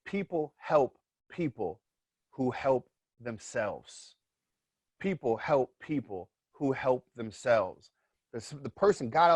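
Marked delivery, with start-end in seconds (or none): 0.1-7.9 slow, emphatic statement; slow, deliberate moral delivered to the audience; moral of the story. Real, not acted. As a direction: land the lesson word by word. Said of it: "Moral of the story".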